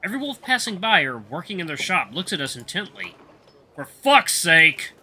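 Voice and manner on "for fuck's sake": a loud, slow furious snarl; snarling anger. A narrator's reading. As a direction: bite the words out slowly in rage. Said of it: "Vul nearly snarls in anger".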